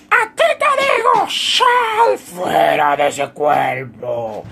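hammed-up preacher rebuking a demon; preacher casting out. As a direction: start thin, end booming like a preacher. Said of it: "los predicadores empiezan con la voz fina y después terminan fuerte".

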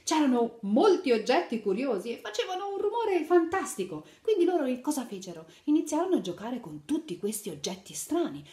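put-on lively read-aloud to little children; animated picture-book narration; storytelling. A narrator's reading. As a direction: tell the fairy tale brightly to kids.

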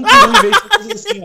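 laughing high and loud; words bubbling with laughter; giggling through the sentence. Real, not acted. Laughing while describing player.

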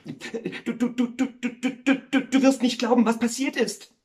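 high, frantic stutter; agitated. Acted. Neighbour breaks news to the miller, agitated and stuttering. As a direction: stammer excitedly, building in volume. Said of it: "sagte er aufgeregt".

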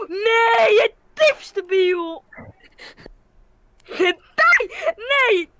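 high, loud anguished yells of no; desperate screaming. Real, not acted. Gamer screaming at friends as his player dies. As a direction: scream it out as everything goes wrong.

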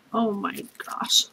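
slow, soft whispered oh my gosh; whispered disbelief; awed whisper. Real, not acted. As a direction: hush it out in awe. Overwhelmed by gifts.

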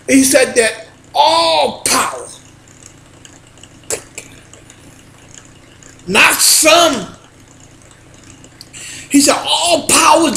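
loud, rising sermon shouts; preaching at full force. Acted. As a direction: preach it hard to the congregation. Preaches about God's authority.

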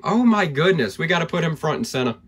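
normal talking voice

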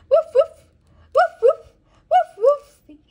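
very high, loud yappy woofs; barking dog. Acted. Mother barking like a puppy for her toddler. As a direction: bark like a little dog. Animal sound game for toddler.

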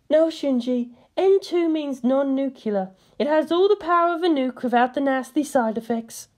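playful high female voice; Misato. A narrator's reading. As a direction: play a teasing young woman.

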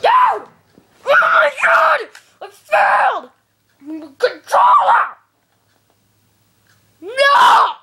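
high, loud wail of frustration; worked-up yelling. Real, not acted. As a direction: cry out as if all is lost. Worked-up outburst over failing the game.

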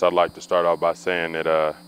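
ordinary talk

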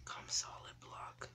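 slow, breathless whisper; whispering while hiding. Real, not acted. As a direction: whisper it so the hunters can't hear.

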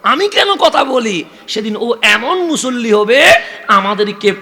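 fervent sermon build; impassioned preaching. Acted. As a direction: lift the congregation with the child's vow. Preacher swells again.

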